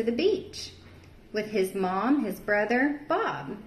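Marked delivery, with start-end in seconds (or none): none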